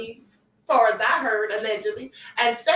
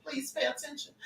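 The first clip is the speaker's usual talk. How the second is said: eerie hushed imitation; 13 dB quieter than usual. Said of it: "that's just what it sounded like".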